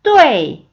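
뚜에이 as one loud syllable sliding sharply down; falling fourth tone. Shown as a demonstration. Teacher demonstrating fourth tone, 뚜에이 loud and falling. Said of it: "이렇게 들어갑니다".

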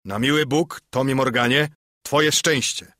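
host exclaims loudly at the sailor; innkeeper exclaiming. A narrator's reading. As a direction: call it out, half scolding. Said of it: "zawołał gospodarz".

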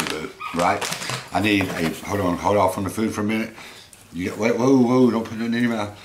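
ordinary talk, nothing marked

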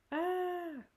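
high, loud scream of fright; startled scream. Acted. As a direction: shriek as if seeing a bear. Customer screams at bear.

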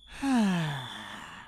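long tired sigh, dropping low; heavy sigh. Acted. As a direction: sigh like a disappointed parent. Disappointed sigh at late friend.

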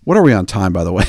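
normal talking voice

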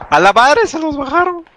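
exclamación aguda de asombro; sorprendido. Real, not acted. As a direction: asombrado al ver la muerte. Ve que mataron a otros. (voz aguda de sorpresa)

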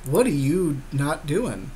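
ordinary talk

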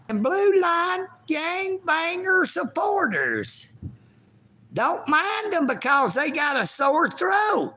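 high, sassy voiced pet cat. Owner voices his kitty cat mocking commenters, high and sassy. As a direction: speak for the cat in a high put-on voice. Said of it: "Cat, you be talking like that".

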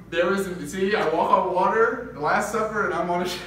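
loud, high, laughing through it; joking Jesus voice, giggling; laughing mock parable. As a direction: ham up playing Jesus on a boat.

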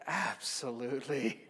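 slow, stretched-out yes; emphatic agreement. Retold or imitated. Priest agreeing to hear a confession, slow emphatic yes. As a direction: draw the word right out.

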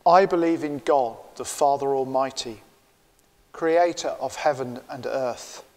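ordinary talk, nothing marked

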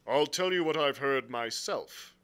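lightly voiced frank captain; blunt sea captain. Captain answering the angry squire, bluntly. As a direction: answer with a touch of stiff formality. Captain's dialogue beside narration.

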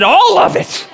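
high squealed mock defiance; hammed-up frantic confession; defiant outburst. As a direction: blurt it out far above normal pitch. Retold defiant answer to dad.

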